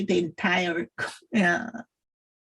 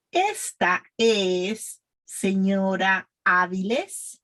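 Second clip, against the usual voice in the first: slow, word-by-word teacher's model; pronunciation practice; slower than usual. Shown as a demonstration. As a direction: spell it out slowly for learners to repeat. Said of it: "practice with me".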